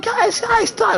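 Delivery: high, shouted pleas to stop; girlfriend pleading. Retold or imitated. Retelling how the girlfriend begged the fighters to stop, high shout. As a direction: squeal at them to break it up.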